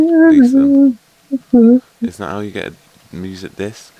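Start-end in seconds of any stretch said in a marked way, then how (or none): 0.0-0.9 loud high call across the house; loud high call to Lisa. Real, not acted. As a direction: call her from afar. Calling out to someone.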